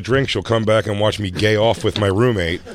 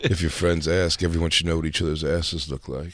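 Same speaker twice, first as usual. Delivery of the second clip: Johnny Depp impression; 4 semitones lower, 5 dB quieter than usual. Teasing a friend about showing his butt, in a light Depp voice. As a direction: murmur it like a lazy movie star, lightly. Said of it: "That's your Johnny Depp impression".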